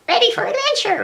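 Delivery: very high, squeaky helium-style voice. Retold or imitated. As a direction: replay the squeaky midnight catchphrase. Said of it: "[name], you did a high voice".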